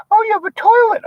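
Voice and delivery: very high falsetto delight; high-pitched mock girlfriend gasping at the view; gushing girlfriend. Wife voiced gushing over apartment.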